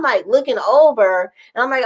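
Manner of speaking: ordinary talk, nothing marked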